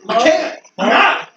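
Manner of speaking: high, loud, frantic yell. Acted. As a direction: yell it in a panic. Player yelling in scene.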